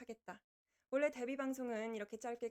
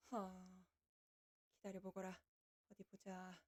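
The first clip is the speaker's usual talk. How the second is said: soft muttered wait to self; quiet hold on; 3 semitones lower, 8 dB quieter than usual. Real, not acted. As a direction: murmur it while busy fixing. Muttering while fixing screen. (작게 중얼거리듯 기다리라고)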